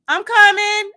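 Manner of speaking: high, loud yell; yelling back. A narrator's reading. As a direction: shout it back from upstairs. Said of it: "I yelled".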